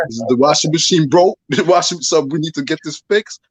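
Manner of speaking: mock woman's voice. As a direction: play her up, half-laughing. Imitating the woman's reply, laughing.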